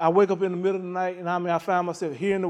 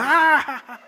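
mock laugh; 5 semitones higher than usual. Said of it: "I'm just laughing".

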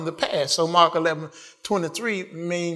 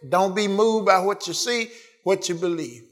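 ordinary delivery

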